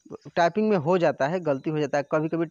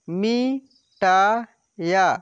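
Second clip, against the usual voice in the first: ना and रा stretched apart, louder; very slow syllables; 2 semitones higher, 6 dB louder, much slower than usual. Shown as a demonstration. Teacher sounding out syllables for pupils, very slow. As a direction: spell it out slowly, syllable by syllable. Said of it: "direct आपको बोलना है कि".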